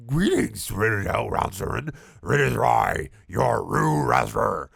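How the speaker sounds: slow, sinister villain voice; evil figure. Acted. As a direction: menace every word like a villain. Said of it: "said the figure with evil words".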